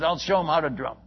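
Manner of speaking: ordinary delivery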